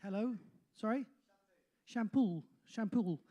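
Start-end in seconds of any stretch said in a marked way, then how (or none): 1.8-2.4 "Shampoo" with an L stuck on the final vowel; Bristol added L. Shown as a demonstration. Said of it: "an L on the end". 2.6-3.2 "Shampoo" closed on an extra L; Bristol added L. Shown as a demonstration. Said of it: "an L on the end".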